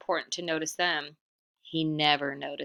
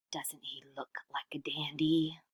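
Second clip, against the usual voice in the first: whisper; 5 dB quieter than usual. Acted. Whispered aside.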